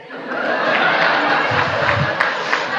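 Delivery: helpless, silent-shaking laughter; breathless laugh. Real, not acted. Preacher cracking up after a ringtone goes off mid-sermon, laughing. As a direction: caught off guard and can't stop laughing.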